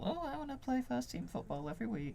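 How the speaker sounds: high, whiny mock footballer voice. Mocking a dropped footballer who whined for first team football, high and whiny. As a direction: sneer it in a whiny put-on voice. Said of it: "After him being all".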